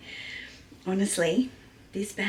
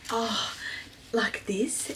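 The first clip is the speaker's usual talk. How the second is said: usual speaking voice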